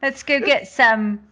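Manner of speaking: a hammed-up, badly faked Irish lilt; terrible Irish accent. Mock Irish accent, showing off to the podcast host. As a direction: put on a bad Irish accent for Saint Patrick's Day. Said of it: "terrible Irish accent".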